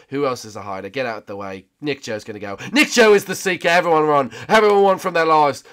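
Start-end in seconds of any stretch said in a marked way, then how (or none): 2.7-5.6 loud, high mock-panicked warning; shouted alarm. Player warning everyone that Nick Joe is Seeker, shouted alarm. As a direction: sound the alarm to all players.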